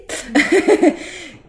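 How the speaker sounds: short high laugh. Real, not acted. Laughs after grim joke.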